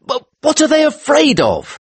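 very high, bewildered protest; agitated, astonished outcry; incredulous cry. Acted. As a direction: demand it in high-pitched disbelief. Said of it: "I cried".

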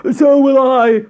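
high, loud frog yell. A narrator's reading. Frog leaping from bed for bread, yelling. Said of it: "yelled the Frog".

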